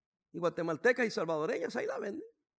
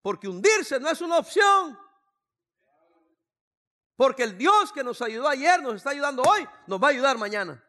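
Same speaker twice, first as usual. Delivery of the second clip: defiant shout; 5 semitones higher, 10 dB louder than usual. Retold or imitated. Pastor proclaiming trust in God to the congregation, shouting. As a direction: shout it like a battle cry of faith.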